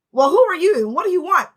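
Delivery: loud, high challenge to a stranger; confrontational. Retold or imitated. Snappy stranger in a retold confrontation.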